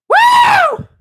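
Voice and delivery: sudden high, loud "woo"; loud whoop. Acted. Streamer shaking it off after praying, loud whoop. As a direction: burst out with a piercing whoop.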